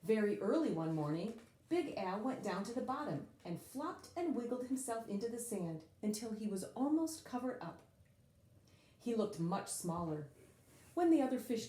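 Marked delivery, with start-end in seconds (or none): none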